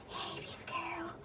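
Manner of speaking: whispered greeting to a pet; soft whispered hello to the dog. Real, not acted. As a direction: coo it softly to the dog.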